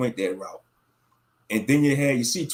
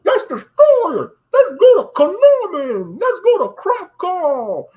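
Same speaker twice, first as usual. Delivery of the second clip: much higher, loud, slow villain voice; over-the-top Bane voice, loud and slow; Bane impression; 13 semitones higher, 8 dB louder, slower than usual. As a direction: ham up a masked villain ranting. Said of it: "I'm not Bane off of Batman, The Dark Knight Rises. Be like".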